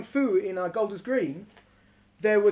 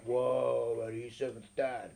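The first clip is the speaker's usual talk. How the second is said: low, soft, impressed aside; mock hushed awe; 12 semitones lower, 10 dB quieter, slower than usual. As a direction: imitate quietly awestruck onlookers.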